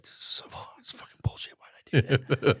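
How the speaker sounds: whispered self-reproach; retold anxious whispering; dreading whisper. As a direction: curse to yourself under your breath. Child dreading punishment all day.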